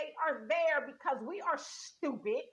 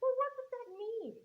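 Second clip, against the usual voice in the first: puzzled questioner; 7 semitones higher, 4 dB quieter than usual. Retold or imitated. Asking the slogan chanters a question.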